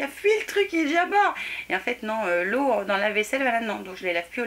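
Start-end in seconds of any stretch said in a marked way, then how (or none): 0.0-1.3 high startled cry; alarmed exclamation. Retold or imitated. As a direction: yelp it out in alarm. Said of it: "J'ai dit".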